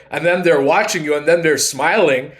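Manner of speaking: ordinary talk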